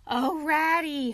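high, drawn-out, loud exclamation; excited cry. A narrator's reading. Toad greeting Ratty after his adventures, crying out. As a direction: cry out to a dear friend. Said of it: "he cried".

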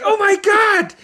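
high gasping oh my God; retold shock. Retelling the crash to his friend, a high shocked cry. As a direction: squeak it out in mock horror.

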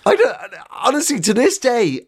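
ordinary talk, nothing marked

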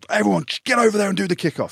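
high, loud barked order; coach ordering team. Retold or imitated. Coach sending the squad away, loud high order. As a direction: order everyone off to the kickoff. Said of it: "he's like".

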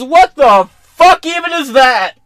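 high, loud disgusted yell; baffled shout. Retold or imitated. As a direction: blurt it out, loud and appalled. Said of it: "He goes".

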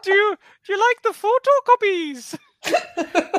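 put-on very high, loud old-lady falsetto; exaggerated high-pitched housekeeper impression; Missus Doubtfire. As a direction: trill it out as a hammed-up nanny greeting. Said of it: "that was the accent I was gonna use".

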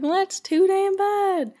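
put-on slow, high Southern old man; Southern accent. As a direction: drag it out in a bad Southern twang. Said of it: "really bad kind of Southern accent".

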